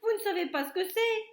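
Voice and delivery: loud, fast, near-crying complaint; wailing protest. A narrator's reading. Cook complaining to the laughing kitchen, loud near-crying whine. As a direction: whine it out, aggrieved. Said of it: "criait l'homme".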